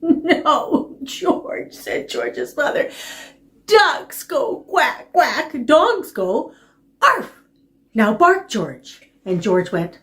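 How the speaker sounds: exaggerated storytime teaching; reading to a toddler. Mother voiced to puppy.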